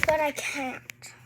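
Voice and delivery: slow, whispered letdown; whispered regret. Real, not acted. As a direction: breathe it, disappointed.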